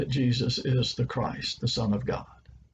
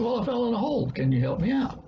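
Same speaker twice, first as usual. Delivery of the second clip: lightly voiced stuck man, pitched higher; a touch of a pleading high voice; man in the hole; 10 semitones higher, faster than usual. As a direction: plead upward from the bottom of a hole.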